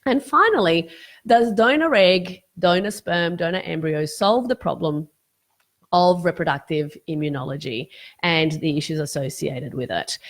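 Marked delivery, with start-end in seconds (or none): none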